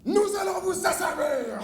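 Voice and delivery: loud, high menacing cry; villain shout. Acted. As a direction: threaten them with a roar. Méchant menace en criant. (cri menaçant)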